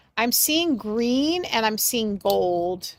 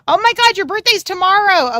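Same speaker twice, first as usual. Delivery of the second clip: much higher, loud delighted exclamation; excited surprise; 10 semitones higher, 11 dB louder than usual. Real, not acted. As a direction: gasp it out, thrilled by the news. Learns birthday is tomorrow.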